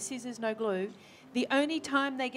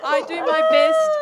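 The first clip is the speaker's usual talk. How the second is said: laughing reply; 6 semitones higher, 13 dB louder than usual. Real, not acted. Replying through laughter to joke.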